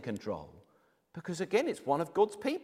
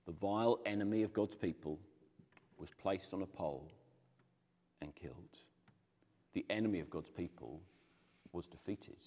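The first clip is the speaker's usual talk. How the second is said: solemn hushed narration; 9 semitones lower, 10 dB quieter than usual. A narrator's reading. Solemn summary of Haman's end.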